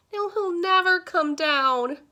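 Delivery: high, slow, loud wail; lamenting. A narrator's reading. Mister Sims watches his cat up a tree, lamenting. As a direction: wail it out, sinking slowly. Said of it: "Mister Sims lamented".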